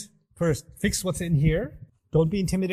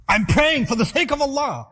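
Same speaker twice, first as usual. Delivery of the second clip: loud, high defensive snap; getting angry; 9 semitones higher, 7 dB louder than usual. Shown as a demonstration. Young person defending prayer to family, angry. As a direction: bristle and push back hard. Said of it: "now you're getting angry".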